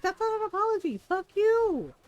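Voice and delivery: indignant shout of that's not an apology; angry retort. Retold or imitated. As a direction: shout it back, fed up. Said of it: "I was like".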